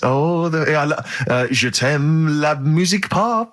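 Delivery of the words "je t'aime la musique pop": slow, hammed-up French accent; mock French. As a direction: put on a silly French accent. Mock French cheese lines.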